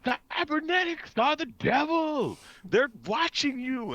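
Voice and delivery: high, drawn-out mock preacher yell; yelling religious zealot. Religious guy in the game warning passersby, high mock yelling. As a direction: wail it slowly like a street doomsayer. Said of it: "the religious guy was, like, yelling".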